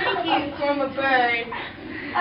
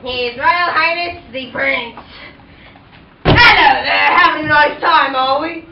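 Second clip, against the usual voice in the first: loud, low Cockney prince; 3 semitones lower, 7 dB louder than usual. Acted. The prince flirting with Cinderella at the ball, Cockney accent. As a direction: play the prince as a cheeky London geezer.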